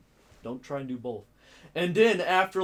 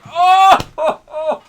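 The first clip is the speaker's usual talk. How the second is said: shouting out in sudden delight, higher and louder; a big excited yell of joy; 19 dB louder than usual. Retold or imitated. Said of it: "I was like".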